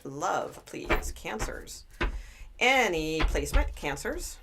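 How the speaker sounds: ordinary delivery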